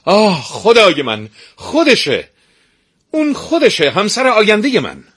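loud, very high overjoyed shout; the prince finds Mara and cries out with joy; joyful cry. Acted. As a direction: cry out in delight, unable to hold back. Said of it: "بی اختیار فریاد کشید".

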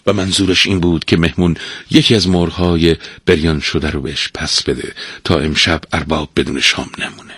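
ordinary delivery, nothing marked

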